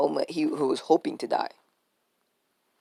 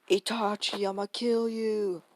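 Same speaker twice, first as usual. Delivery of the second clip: higher voice, slow, half-hearted threat; mock vengeful Sasuke; 7 semitones higher than usual. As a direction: play the avenging brother, hammed-up and higher. Sasuke's expected vengeful line.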